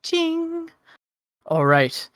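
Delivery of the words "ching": high, thin ringing "ching"; imitated metallic clang. Game master voicing the parry, high metallic ching. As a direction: make a high blade-on-buckler ring. Imitating a blade being parried.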